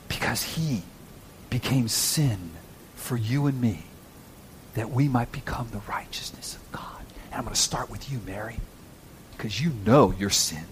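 hushed and solemn, with weighted pauses; tender hush. Real, not acted. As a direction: confide it gently, as if to one person. Intimate gospel appeal in a hush.

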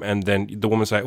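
usual speaking voice

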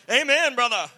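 loud, high "Amen, brother" holler; hammed-up amen shout from the pews; acted congregant's amen. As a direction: imitate an eager churchgoer shouting back. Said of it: "you're like".

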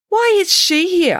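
loud, high, slow indignant question; glaring demand. A narrator's reading. Teacher glaring at an uninvited girl, indignant demand. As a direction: demand it slowly, pitched right up. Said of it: "asked Mister Spencer as he glared".

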